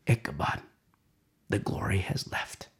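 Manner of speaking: grave whisper, almost breathless; hushed whisper. Real, not acted. Preacher whispers the loss of glory to the congregation. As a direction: let it sink in a whisper. Solemn point after defeat.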